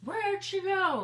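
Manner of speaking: high, slow, loud puzzled voice; mock the confused viewers. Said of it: "you guys are probably like".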